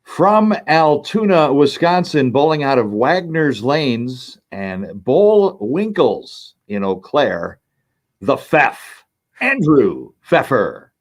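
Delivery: slow, drawn-out arena player intro; PA announcer voice. Shown as a demonstration. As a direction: call the bowler out like the house announcer. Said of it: "in the P A announcer voice".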